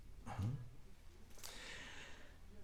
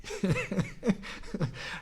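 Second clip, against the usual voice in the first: higher-pitched chuckle; laugh; 8 semitones higher than usual. Real, not acted. Preacher delighted by his own story, laughing. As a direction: laugh between sentences of the story.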